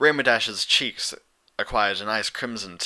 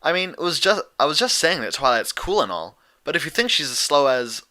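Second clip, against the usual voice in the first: higher, louder needling; smug teasing; 5 semitones higher, 6 dB louder than usual. A narrator's reading. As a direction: goad her smugly. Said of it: "smug now".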